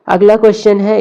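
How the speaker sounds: usual speaking voice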